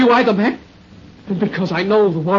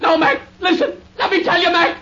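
pleading through tears; 7 semitones higher than usual. Acted. Delirious soldier pleads.

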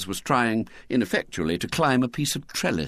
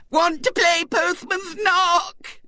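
put-on high, slow, shrill child's demand; shrill little girl; 4 dB louder, slower than usual. A narrator's reading. Violet Elizabeth demanding a party game from the grown-ups. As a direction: insist like a spoilt little girl. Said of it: "demanded Violet Elizabeth shrilly".

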